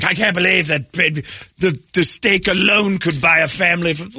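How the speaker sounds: mock outrage. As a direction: parody the scolds with a slow, high sneer. Mocking critics of Salt Bae.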